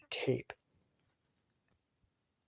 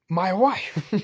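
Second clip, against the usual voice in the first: smitten outburst; 7 semitones higher, 9 dB louder than usual. Retold or imitated. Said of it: "was like".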